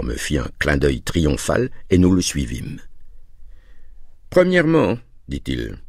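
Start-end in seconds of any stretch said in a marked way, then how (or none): 4.3-5.0 high-pitched, theatrical announcement; salesman's opening flourish, pitched way up; grand announcement. A narrator's reading. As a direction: announce the first item like a showman. Dealer begins his grand offer.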